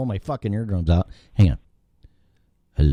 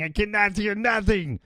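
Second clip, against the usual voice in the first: much higher, escalating repeat; high-pitched complaint; 12 semitones higher, 4 dB quieter than usual. Acted. Host with dead Skype audio, high exasperated complaint. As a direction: push it way up in pitch, each word climbing.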